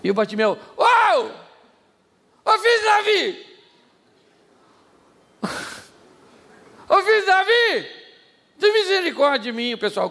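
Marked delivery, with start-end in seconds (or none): 0.8-9.8 loud pleading cry, much higher. Retold or imitated. Bartimeu shouts to Jesus over the crowd, loud pleading cry.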